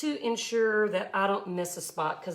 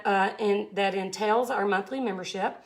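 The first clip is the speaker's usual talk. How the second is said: usual speaking voice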